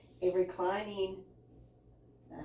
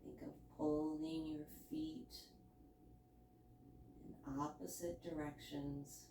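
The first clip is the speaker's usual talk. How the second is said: hushed guided relaxation voice, much softer; soft spa whisper; 2 semitones lower, 14 dB quieter than usual. Acted. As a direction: soothe them into stillness, very softly. Guides relaxation in yoga pose.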